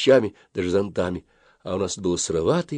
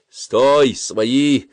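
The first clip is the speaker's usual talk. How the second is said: raised, loud but courteous call; polite shout; 5 semitones higher, 6 dB louder than usual. A narrator's reading. Boy calls to a passing little girl, politely shouting. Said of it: "вежливо крикнул Колупаев".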